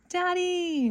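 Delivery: high, loud happy call; little fish calling out to his father. A narrator's reading. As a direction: call across the water to dad. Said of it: "Nemo called out".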